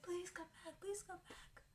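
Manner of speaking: very high, softening plea; begging. Retold or imitated. Girl begging the boyfriend to come back, very high and fading.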